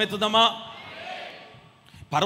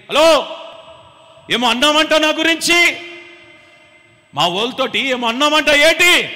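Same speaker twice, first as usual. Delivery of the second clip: loud, higher, taunting challenge; arrogant king; 6 semitones higher, 9 dB louder than usual. Retold or imitated. Bedridden king taunting the servant, loud arrogant challenge. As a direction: sneer at the servant from the bed. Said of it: "అన్నాడు ఈ సేవకుడిని".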